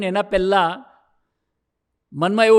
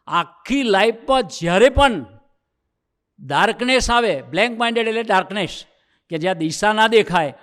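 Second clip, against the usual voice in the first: fervent preaching; 3 semitones higher, 4 dB louder than usual. Acted. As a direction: build it up like a sermon to the hall. Preaching the lesson of the story. (ઉત્સાહથી પ્રવચન, ઊંચા અવાજે)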